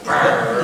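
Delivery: short growling cry like a cartoon devil; Tasmanian devil yell. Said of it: "I think of the Tasmanian devil".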